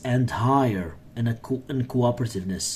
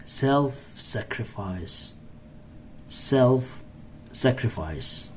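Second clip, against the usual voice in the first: slow model word; 5 dB quieter, much slower than usual. Shown as a demonstration. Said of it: "teacher repeats word slowly".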